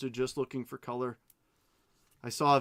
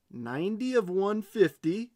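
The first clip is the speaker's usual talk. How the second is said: excited; 7 semitones higher, 6 dB louder than usual. Real, not acted. Reading serial number excitedly.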